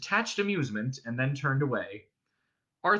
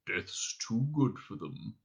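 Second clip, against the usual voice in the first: slow, low grim verdict; grim musing; 4 semitones lower, 6 dB quieter, slower than usual. A narrator's reading. Captain alone closing his book, grimly musing. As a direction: say it darkly to yourself, drawn out.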